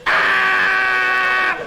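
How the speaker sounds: sudden loud high cry; ant mating call shout. Pretend. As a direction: yell it out as a tiny insect call. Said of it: "That is the mating call of a worker ant".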